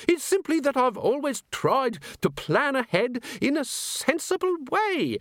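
prim, outraged curator; squeaky fussy curator. Acted. Fading ghost curator.

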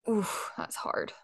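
breathy, hushed and slow, weighing a hard choice; soft torn groan. Real, not acted. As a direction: sigh it out slowly, stumped.

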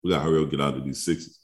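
ordinary talk, nothing marked

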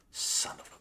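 whispered curse under breath; whispering. Retold or imitated. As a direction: hiss it unvoiced. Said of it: "And she's like".

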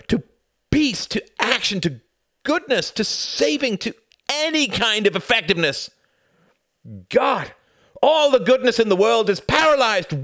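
loud, high furious outburst; furious shout. Acted. Son shouting at his mother in fury. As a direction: rail at her at full force.

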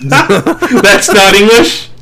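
slow, laughing through it; giggling disbelief; cracking up at the clip. Real, not acted. Laughing at music video.

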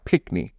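pickney said PICK-nee, short i, no k before the n; Jamaican word pickney. Shown as a demonstration. Jamaican husband teaching his wife the word pickney. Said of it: "what it looks like phonetically".

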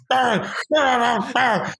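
high, strained grunting barks like a meathead; mock Steiner barks. A podcaster imitating Steiner's angry promo barks. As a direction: bark it out like a confused wrestler. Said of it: "angrily barks".

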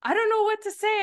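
high, small flustered voice; friend's nervous reply, lightly mocked; mimicking nervous friend. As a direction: play her as shy and stuck.

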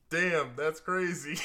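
loud, high, drawn-out fake amazement; acted surprise. Son opening a present he has already seen, feigned surprise. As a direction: overplay being surprised, laughter creeping in. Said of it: "you have to act surprised".

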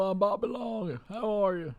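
high, put-on Southern accent; heavy Southern accent, hammed-up; Bobby Long. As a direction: drawl it like a Southern movie character. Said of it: "like, a very deep, heavy Southern accent".

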